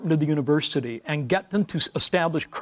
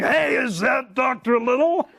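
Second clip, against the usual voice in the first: raised, slow mock gravelly call; 8 semitones higher than usual. Extrovert greets him from the next stall, hammed-up gravelly call. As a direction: boom out a cheery greeting across the stalls. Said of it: "this gravelly voice saying".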